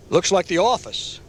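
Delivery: wry remark. A narrator's reading. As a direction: toss it off dryly. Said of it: "Jerry said".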